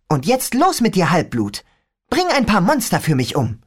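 put-on a young girl griping about her father; teen girl voice. Rachel cheering him off.

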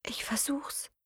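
tender whisper; softly. Acted. Aroa answering dying Matteo, soft whisper. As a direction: whisper it gently to him. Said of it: "sagte sie leise".

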